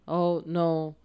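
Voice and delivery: usual speaking voice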